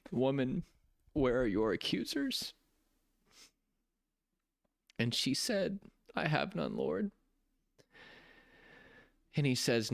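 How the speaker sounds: trying not to cry while telling it; holding back tears. Real, not acted. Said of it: "I'm gonna try not to get emotional".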